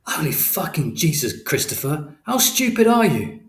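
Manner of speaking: rising into a loud, high furious yell; angry father. A narrator's reading. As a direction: berate the boy, building to a shout. Angry father berates son.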